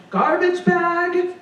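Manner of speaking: slow, high sing-song call of garbage bag; routine call. Retold or imitated. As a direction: drag out garbage bag like a bored shop call. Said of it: "because I would say it every five seconds".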